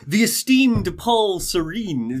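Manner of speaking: playful pomp with a little bow; mock-grand greeting. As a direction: announce him like visiting nobility.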